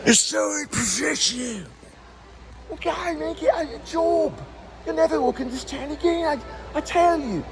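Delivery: boss yelling. Retold or imitated. Imitating the boss yelling about the lights, high mock scolding. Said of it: "he'll yell at me".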